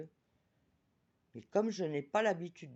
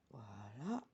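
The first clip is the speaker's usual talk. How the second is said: soft whisper; whispered voilà; 6 semitones lower, 12 dB quieter than usual. Real, not acted. Closing the card reading for viewers, whispered. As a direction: breathe it out as a whisper.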